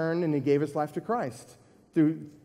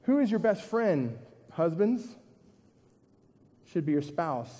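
ordinary talk

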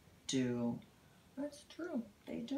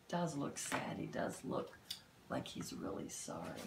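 ordinary delivery, nothing marked

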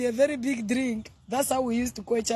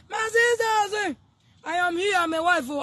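proud proclamation; 8 semitones higher than usual. Real, not acted. Proclaiming she is a wife.

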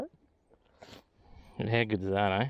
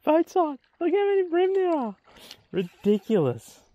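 high, loud amazed squeal; excited squeal; 4 dB louder than usual. Real, not acted. Angler amazed at bream by the boat, high squeal. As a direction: gush at the swarm of fish.

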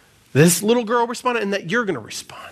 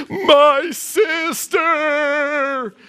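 very slow, high, wailed confession; crying little girl; 6 semitones higher, much slower than usual. Retold or imitated. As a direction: imitate a child sobbing out a confession. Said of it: "Finally, the little girl goes".

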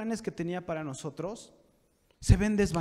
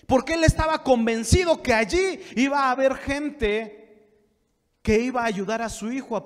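raised pulpit proclamation; emphatic preaching; 7 semitones higher, 6 dB louder than usual. Real, not acted. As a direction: proclaim it to the congregation in a higher voice. (prédica enfática con voz elevada)

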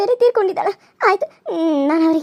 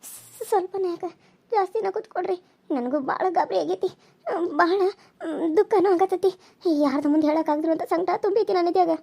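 hushed distress; 6 dB quieter than usual. Acted. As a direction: murmur it, scared to be heard. Fearful girl speaks hushed. (ಪಿಸುಗುಟ್ಟುವ ದುಗುಡ)

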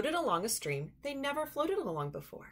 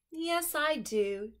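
higher, slow, lightly voiced duck; Duck's gentle reply; 7 semitones higher, slower than usual. Duck agrees with Goose in a slow, high voice. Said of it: "Duck agreed".

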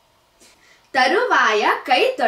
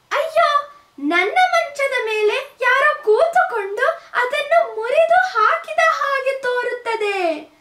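much higher, weeping cub voice; crying baby bear; 13 semitones higher than usual. A narrator's reading. Baby bear finds his bed broken, crying high. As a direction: sob it like a tiny cub. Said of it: "ಎಂದು ಅತ್ತಿತು".

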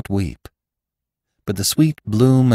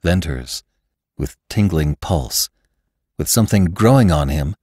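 ordinary delivery

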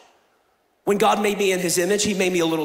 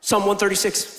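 normal talking voice

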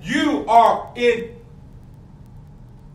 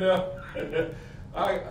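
ordinary delivery

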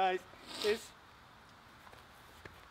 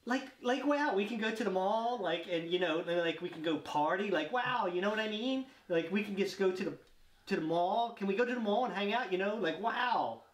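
mock valley girl with rising ends; valley girl accent. Player gushing about the mall in accent challenge, valley girl accent.

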